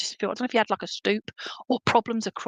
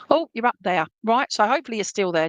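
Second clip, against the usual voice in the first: ordinary talk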